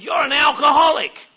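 loud, blunt accusation; the guy yelling it in his face; confronting shout. Retold or imitated. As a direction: hit him with it loud. Guy repeats louder.

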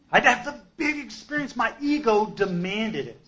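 high-pitched, grandiose self-mockery; hammed-up big-ego declaration; self-mocking bluster. Mocks his own ego's demand.

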